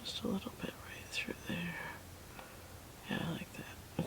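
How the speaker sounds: soft unvoiced whisper while painting. Real, not acted. Painter whispering brush tips to her viewers, hushed whisper. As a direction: hush it right down, breathy whisper on every word. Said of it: "I don't know why I'm whispering".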